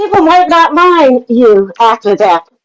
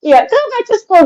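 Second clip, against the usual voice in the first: high mocking taunt; 10 semitones higher than usual. Real, not acted. Taunting during an argument.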